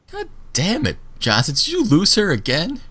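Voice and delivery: high, scolding voice; exasperated prisoner. Acted. Prisoner scolding Johnson for losing Meredith, high and exasperated. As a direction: scold him like everyone else does. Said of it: "He looks at you and says".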